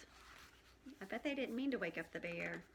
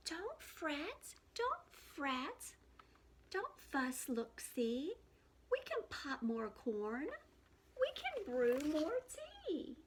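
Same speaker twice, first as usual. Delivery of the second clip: lightly voiced little mouse, a bit higher; squeaky mouse; 5 semitones higher, slower than usual. Mouse cheering up the sad bear, lightly squeaky. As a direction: comfort the bear like a tiny squeaky friend. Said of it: "Mouse squeaks".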